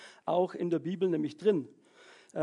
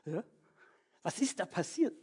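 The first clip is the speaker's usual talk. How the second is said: ordinary delivery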